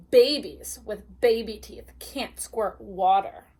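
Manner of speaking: ordinary delivery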